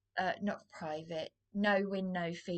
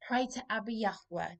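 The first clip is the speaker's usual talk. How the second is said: ordinary delivery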